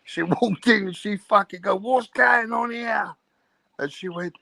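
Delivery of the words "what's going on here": high, slow, shouted outrage; angry demand. Retold or imitated. Woman catching her girlfriend cheating, imitated angry shout. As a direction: bellow it like you caught them. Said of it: "with an angry face".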